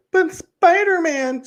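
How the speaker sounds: very high, drawn-out mock whine; mock whining fan. Host mocking fans who can't get over it, whiny imitation. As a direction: imitate a complaining fan, slow squeal.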